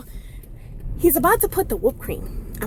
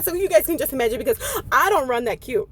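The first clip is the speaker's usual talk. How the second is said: normal talking voice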